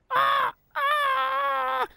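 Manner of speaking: high, loud pained cry. A narrator's reading. Rebecca the rake cries out to Tech in pain. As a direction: wail from the broken tooth. Broken tooth, crying out.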